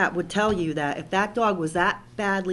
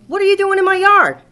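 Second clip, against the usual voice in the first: much higher, loud yell as the dog; 11 semitones higher, 13 dB louder than usual. Retold or imitated. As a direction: bark it out like an angry dog defending its yard. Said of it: "Here's the dog going".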